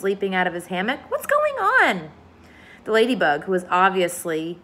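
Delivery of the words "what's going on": alarmed question. A narrator's reading. James alarmed as peach moves.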